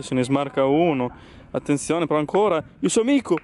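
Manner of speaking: excited sportscaster calling play; breathless italian goal commentary. Pretend. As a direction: build to frantic goal call. Mock football commentary.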